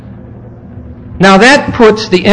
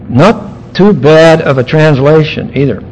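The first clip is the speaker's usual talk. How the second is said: normal talking voice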